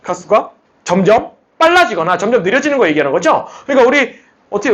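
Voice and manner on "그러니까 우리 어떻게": normal talking voice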